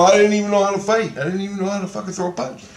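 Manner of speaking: high, rapid-fire complaint; retold high, hurried protest; indignant protest. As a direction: rattle off the excuse in outrage. Protests unfair test to Christ.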